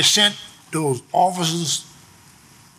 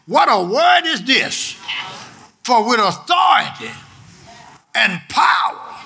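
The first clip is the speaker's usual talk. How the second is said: raised, emphatic pulpit proclamation; full-force preaching; 5 semitones higher than usual. Real, not acted. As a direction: fire up the congregation with each phrase.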